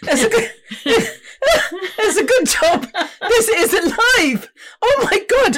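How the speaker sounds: giggling through the words; laughing. Real, not acted. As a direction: laugh while you talk. Laughing at mum's mic antics.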